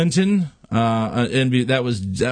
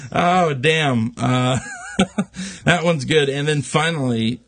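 ordinary talk, nothing marked

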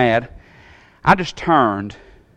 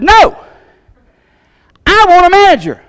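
much higher, louder, insistent shout; angry demand; 6 dB louder than usual. Retold or imitated. Angry customer to family, shouting for a manager. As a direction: plant your feet and demand it.

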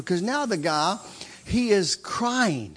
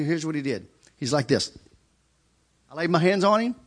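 normal talking voice

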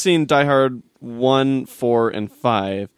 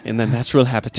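nature documentary narrator; much faster than usual. Pretend. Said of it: "narrate my own nature documentary".

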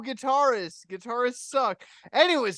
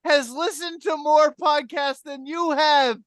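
higher and louder, ribbing a friend with fake outrage; hammed-up indignation, pitched up and loud; scolding a cohost who never listens, in hammed-up outrage; mock outraged rant; 7 semitones higher, 5 dB louder than usual.

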